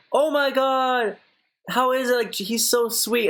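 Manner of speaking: high, drawn-out gushing, imitated; gushing friend. Friend gushing to the speaker about Jake Paul, gushing imitation. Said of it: "he was like".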